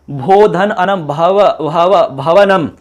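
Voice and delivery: भवनम slowly taken apart, repeating भव; sound-spotting demonstration. Shown as a demonstration. Teacher points out the sound inside, slow demonstration. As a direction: draw out भव so its sound stands out. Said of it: "अब की ध्वनि आ रही है".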